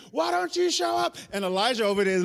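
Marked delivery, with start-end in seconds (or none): 0.1-1.2 high-pitched, impatient imitation of angry prophets; mock angry yell. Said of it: "getting angry".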